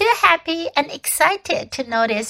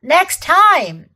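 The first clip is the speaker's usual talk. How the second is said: loud, higher call across distance; queen calling to Edmund from the sledge; 6 semitones higher, 6 dB louder than usual. A narrator's reading. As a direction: call out after him as the sledge leaves. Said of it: "calling out".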